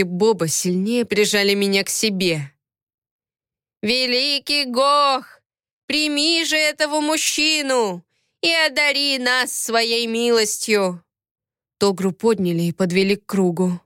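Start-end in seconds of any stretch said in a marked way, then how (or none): 3.8-11.0 slow, drawn-out plea to a god; stretched, reverent chanting call; solemn invocation. A narrator's reading. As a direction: intone it like a ritual prayer, starting high.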